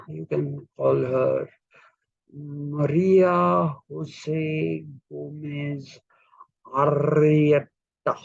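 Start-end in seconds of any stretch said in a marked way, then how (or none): 2.3-8.1 slow Spanish accent with strongly rolled R's in Maria Jose Gomez Arrieta. Shown as a demonstration. Teacher showing how Spanish speakers roll the R, with a Spanish accent. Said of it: "in Spanish, people have a tendency to emphasize the R's".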